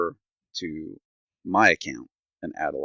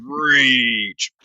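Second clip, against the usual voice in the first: hammed-up outraged oh, a bit louder; mock rage; 6 semitones higher, 6 dB louder than usual. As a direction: play the fury for laughs. Retold customer service fury.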